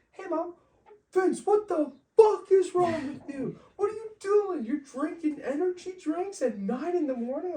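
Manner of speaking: an outraged mother scolding on the phone; furious mom. Retold or imitated. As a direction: mom yelling at her son over energy drinks. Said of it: "I'm like".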